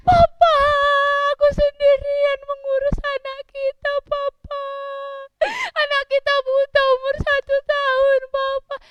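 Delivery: high, loud sobbing lament; hammed-up tearful wail; crying mother. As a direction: wail it out in acted grief. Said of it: "dia nangis".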